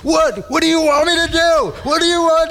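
high-pitched, exasperated yell; imitated angry yelling. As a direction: yell it like an enraged driver confronting someone honking. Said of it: "He starts yelling and starts getting all violent".

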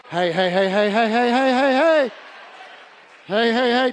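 rapid heys climbing in pitch and volume; mock urgent calling. Real, not acted. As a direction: build up a run of heys to rein in the crowd. Calls excited congregation to order.

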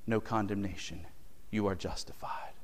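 soft, hushed, nearly whispered. Acted. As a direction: drop to a whisper of awe. Hushed climax of the sermon.

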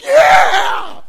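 huge, high, loud cheer; joyful yell. Retold or imitated. Overjoyed young man shouts in worship. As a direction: explode with joy.

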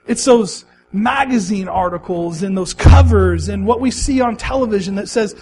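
ordinary delivery

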